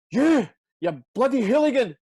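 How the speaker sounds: loud, high outburst imitating an old fan; imitated heckle from the touchline; mock old-man shouting. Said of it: "he starts shouting".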